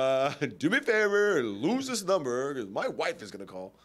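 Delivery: mocking impression, loud and laughing; Tiger Woods. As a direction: play a corny nerd leaving a message, loudly. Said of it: "it's my impression of ... how Tiger Woods".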